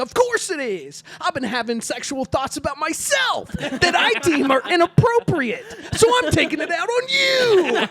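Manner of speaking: high, loud rant building to a comic shout; hammed-up confessional outburst; mock yelling. Sketch bully explaining himself to his victim, mock yelling. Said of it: "Why are you yelling at me?".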